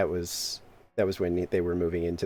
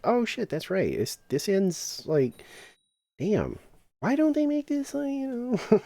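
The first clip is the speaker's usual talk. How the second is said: mock dismay; 14 semitones higher than usual. Player checking the event schedule too late, mock dismay. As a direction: rise into a plaintive little complaint. Acting own reaction to schedule.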